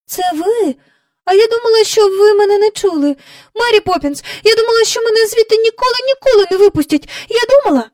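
high, loud, tumbling rush of words; breathless child pouring it all out; overwrought outburst. Acted. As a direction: blurt it out frantically, speeding up. Rescued Jane blurts relief to Mary.

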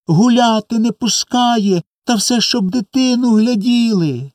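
put-on high, slow, wailing child's complaint; tearful reproach. A narrator's reading. Two boys tell mama their wrongs, tearfully reproachful. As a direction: pour out grievances in a high, drawn-out plaint. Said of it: "з докорами, перебиваючи один одного".

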